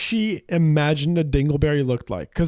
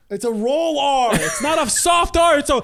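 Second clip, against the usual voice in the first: raised, insistent; 7 semitones higher than usual. Real, not acted. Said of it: "insisting on rolled R".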